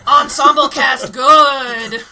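loud, drawn-out zombie grunts; lumbering undead film director; Robert Altman as zombie. Acted. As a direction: shout it slowly like a zombie director. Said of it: "zombie Robert Altman".

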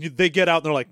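ordinary talk, nothing marked

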